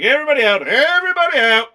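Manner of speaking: loud, high urgent "everybody out"; shouted order. Retold or imitated. Retelling a cop clearing the party, loud mock command. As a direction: bark it like a cop clearing a room. Quoting police ordering evacuation.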